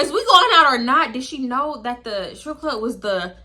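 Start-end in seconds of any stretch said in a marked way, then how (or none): 0.0-1.1 exasperated, pushy retort; blunt demand. Retold or imitated. Blunt contrast to friend's cutesy asking.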